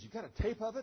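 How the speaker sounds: high, loud incredulous question; retold colonel's startled disbelief; imitated astonishment. Colonel astonished there is a tape.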